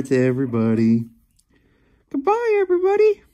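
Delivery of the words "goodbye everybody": much higher, fast squeaky voice for the cat; cat's voice. Pretend. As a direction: speak for the cat like a tiny cartoon pet. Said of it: "I didn't know you could talk".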